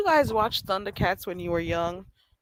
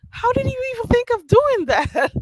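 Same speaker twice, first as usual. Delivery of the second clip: incredulous holler; 5 dB louder than usual. Retold or imitated. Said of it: "I'm like".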